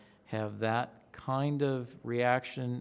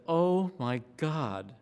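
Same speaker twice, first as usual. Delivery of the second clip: awed disbelief; 5 semitones higher, 3 dB louder, much slower than usual. Retold or imitated. Reaction to up nineteen.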